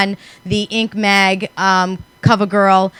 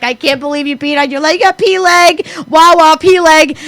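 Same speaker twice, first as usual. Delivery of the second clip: higher, sing-song taunt, slowing on wah wah pee leg; mocking tease; 6 semitones higher than usual. Sister-in-law laughing at her in the car, mocking tease. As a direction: tease her like a schoolyard bully. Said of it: "She's like".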